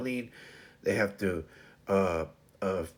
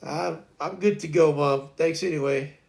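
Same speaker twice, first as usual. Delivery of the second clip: mock baby refusal; 5 semitones higher, 5 dB louder than usual. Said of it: "Atlas just decided to say".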